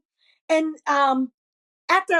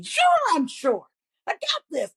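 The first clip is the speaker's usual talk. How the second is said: higher-pitched breezy bravado; cocky; 6 semitones higher than usual. Retold or imitated. Comic assuring the star she can fill the slot, put-on cockiness. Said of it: "I'm being cocky".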